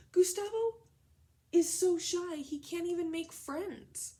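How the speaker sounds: high, loud storybook reading; dramatic read-aloud to children. A narrator's reading. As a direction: make the children feel his shyness. Reads the story aloud to class.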